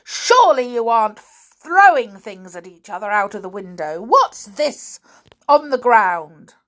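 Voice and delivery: loud and high, hammering the question; loud cry. A narrator's reading. Uncle scolding children for throwing things, loud cry. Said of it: "he cried".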